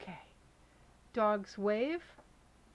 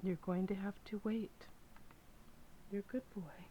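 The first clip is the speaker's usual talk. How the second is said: soft unvoiced whisper to the dog; hushed whisper asking the dog to wait; 6 dB quieter than usual. Real, not acted. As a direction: breathe it out, barely voiced. Telling dog to wait quietly.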